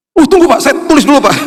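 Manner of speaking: high, loud eager reply; eager courier. Retold or imitated. (kurir bersemangat, suara jauh lebih tinggi)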